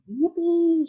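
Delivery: high, loud whoop of glee; joyful shout. Acted. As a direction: whoop like a thrilled old man. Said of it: "shouted Grandpa Joe".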